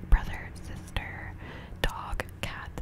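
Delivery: ordinary talk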